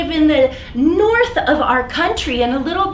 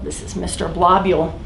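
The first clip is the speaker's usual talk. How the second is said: usual speaking voice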